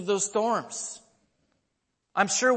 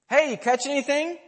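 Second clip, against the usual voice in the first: bright high call across the water; shouting a friendly question to the boat; 8 semitones higher, 4 dB louder than usual. Retold or imitated. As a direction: hail the boat from the beach, higher and louder. Man calls out to fishermen.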